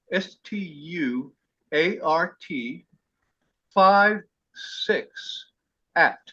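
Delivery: slow, letter by letter, each letter apart; spelled slowly. Shown as a demonstration. Host spells his email address slowly for the group, letter by letter. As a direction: spell it out slowly so they can write it down. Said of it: "I'll say it slowly".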